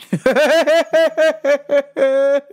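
loud burst of laughter; laughing. Real, not acted. Host laughing at his universe rant. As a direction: crack up at the bit.

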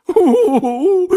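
high, loud dismayed Oh; a beaver's cry of dismay. A narrator's reading. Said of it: "si lamentò Arturo". (Oh sgomento, acuto e forte)

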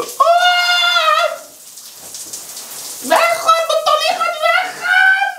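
high panicked shrieks; cold shower screaming. Real, not acted. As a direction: shriek as icy water hits.

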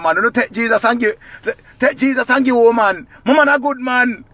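ordinary delivery

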